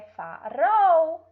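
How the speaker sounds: "farol" with a rolled tongue-tip R; loud trilled R in "farol"; weak trembling R. Shown as a demonstration. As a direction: stretch "fa-ROL" loudly, tongue trembling. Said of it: "treme a língua".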